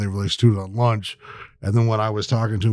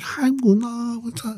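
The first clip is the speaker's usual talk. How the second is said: put-on much higher, cutesy girly voice; imitating TikTok teacher girls; 11 semitones higher, slower than usual. Mimicking the Mandarin TikTok teachers, high and cutesy. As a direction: chirp it like a bubbly young tutor. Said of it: "They're like".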